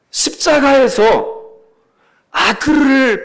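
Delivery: slow solemn drawl. Acted. Drawn-out solemn word.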